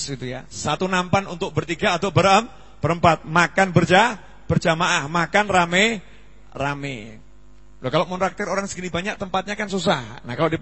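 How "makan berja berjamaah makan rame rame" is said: ordinary talk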